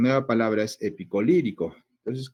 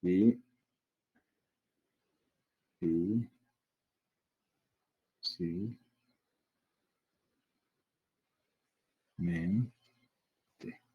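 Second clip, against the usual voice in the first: very long pauses between the pieces, trailing softer; quiet piece-by-piece spelling out, as if writing it; syllable by syllable; 3 semitones lower, 6 dB quieter, much slower than usual. Shown as a demonstration. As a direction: dictate the word slowly in separate syllables. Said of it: "se formaría la siguiente palabra".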